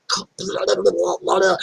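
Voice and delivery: babbled splatter sound effect; spluttering gush noise. Retold or imitated. Storyteller showing pus pouring out, spluttered noise. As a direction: splutter it out like spilling liquid.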